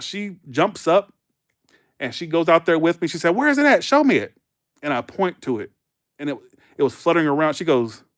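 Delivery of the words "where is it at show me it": high, loud imitated alarm; freaked out. Janet rushing outside to find the bug, alarmed and high. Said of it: "So she freaks out".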